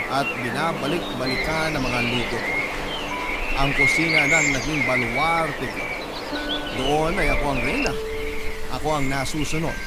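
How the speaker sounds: ordinary delivery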